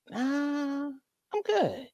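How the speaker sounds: quiet, offhand "nah, I'm good"; soft refusal. Retold or imitated. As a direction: wave it off quietly. Declining the nudist host again.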